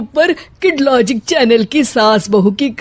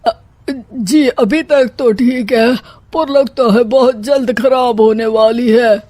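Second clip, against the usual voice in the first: hesitant, frightened stammering start; nervous. Acted. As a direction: answer the bandit in dread, dragging the first words. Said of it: "आप इतना घबरा क्यों रहे हैं". (घबराई, हिचकती आवाज़)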